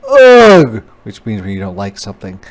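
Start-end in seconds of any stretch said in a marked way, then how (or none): 0.1-0.8 loud, high "Ugh" of disgust. A narrator's reading. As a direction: pull away with a big "Ugh". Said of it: "said the bug".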